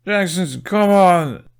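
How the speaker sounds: loud call through chattering teeth. A narrator's reading. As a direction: shout while teeth chatter. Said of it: "Snotlout called through violently clattering teeth".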